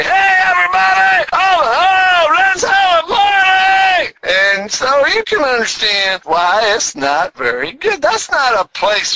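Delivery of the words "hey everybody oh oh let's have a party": high, loud partying whoop; hammed-up party shout; party neighbors. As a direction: whoop it up like rowdy upstairs neighbors. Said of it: "they say".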